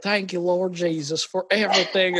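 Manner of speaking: imitated Southern churchgoer's prayer; thick Southern accent. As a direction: pray like a Georgia church man, Southern accent laid on thick. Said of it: "their Southern accent was so tough".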